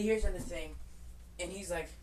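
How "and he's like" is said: normal talking voice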